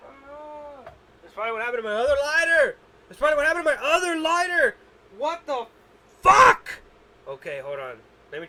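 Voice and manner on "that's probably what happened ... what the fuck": excited exclamation. Real, not acted. Realizing about lighter, exclaiming.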